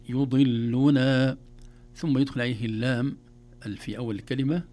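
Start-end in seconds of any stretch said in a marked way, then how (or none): 0.1-1.4 loud, slow يضلنا; word in stages. Shown as a demonstration. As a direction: model يضلنا for beginners, vowels drawn out. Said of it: "ثم يقول".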